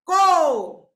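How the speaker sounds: loud, drawn-out "કો"; sound demonstration. Shown as a demonstration. Teacher answers how it is said, long loud "કો". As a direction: call out "કો" with a long o. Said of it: "શું બોલાય છે?".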